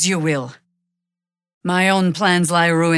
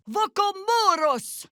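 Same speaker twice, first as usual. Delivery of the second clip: spell cry; 14 semitones higher, slower than usual. Acted.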